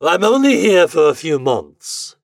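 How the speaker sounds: grand gentleman officer; gentleman's voice. A narrator's reading. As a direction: speak with lofty, courteous assurance. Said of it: "in a gentleman's voice".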